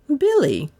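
high-pitched call by name; querying. A narrator's reading. Mistress opens her questioning of the butler, querying. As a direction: call his name high, then let it drop. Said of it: "she queried".

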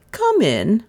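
high, crisp invitation; commanding. A narrator's reading. Mistress tells the dazed stranger to enter, commanding. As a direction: pitch up and instruct him.

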